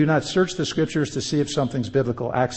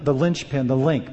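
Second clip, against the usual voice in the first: ordinary delivery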